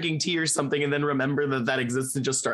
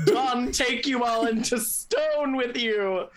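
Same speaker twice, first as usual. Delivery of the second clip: slow, higher theatrical call; hammed-up yell, stretched and raised; mock yelled proclamation; 5 semitones higher, slower than usual. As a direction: draw it out like a grand curse. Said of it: "yell out".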